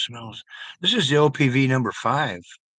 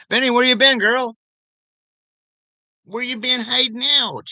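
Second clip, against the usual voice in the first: much higher and louder, playful sing-song; silly pet greeting voice; 10 semitones higher, 7 dB louder than usual. Pretend. Greeting Benny the pet in a loud, high, playful voice. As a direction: call out to the pet like a gushing friend.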